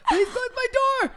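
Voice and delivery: high-pitched squeaky mock plea; barely getting words out. Begging him to shut the door before mom hears, high squeezed-out plea. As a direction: imitate a desperate squeal, far above normal voice. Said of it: "barely getting words out".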